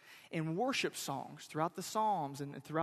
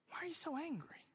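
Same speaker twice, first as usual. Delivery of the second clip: whispered question; 9 semitones higher, 11 dB quieter, faster than usual. Acted. Preacher repeating his question to angry Jonah, whispered.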